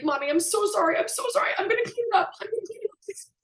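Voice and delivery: put-on very high, tearful little-kid plea, fading to almost nothing; a mother voicing her sobbing son begging forgiveness; imitating sobbing child. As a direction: beg like a small boy crying over a mess. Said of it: "he immediately starts sobbing".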